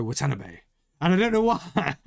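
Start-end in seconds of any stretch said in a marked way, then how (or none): 0.0-0.6 low, softer, lightly announcer-like name; a hint of a ring announcer's accented delivery; wrestling ring announcer. Shown as a demonstration. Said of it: "Japanese names in a wrestling ring announcer voice".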